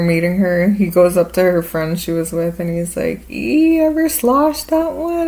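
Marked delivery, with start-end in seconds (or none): 3.3-5.3 high, drawn-out teasing quip; slow, high-pitched imitation of a man; teasing bar quip. Actor to her friend at the bar, high slow teasing.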